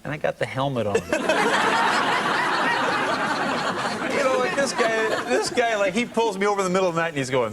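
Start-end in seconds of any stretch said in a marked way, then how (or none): 1.0-4.1 big high-pitched laugh; high burst of laughter. Real, not acted. Laughs at his own helmet story. 4.2-7.1 laughing. Real, not acted. Still laughing at his own story.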